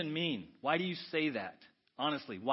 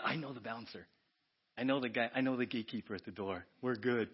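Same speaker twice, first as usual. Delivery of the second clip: lower, softer smug aside; smug confidence; 5 semitones lower, 3 dB quieter than usual. Acted. Clubgoer to friends, low and smug. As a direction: drop the voice, you're connected. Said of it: "confiding about bouncer".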